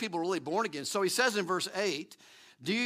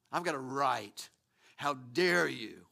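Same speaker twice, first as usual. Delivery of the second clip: lower, slow mock outrage; mock indignation; 6 semitones lower, slower than usual. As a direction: play the offended self-righteous person, dragging it out. Mocking culture's demand for rights.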